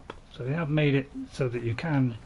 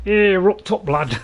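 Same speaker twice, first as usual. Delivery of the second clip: "Here up top, lad" said cleanly in a broad Northern English accent, higher and louder; confident Northern English demo; 5 semitones higher, 6 dB louder, slower than usual. Shown as a demonstration. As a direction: land the Northern English phrase proudly. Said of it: "as we say in, uh, you know, in North England".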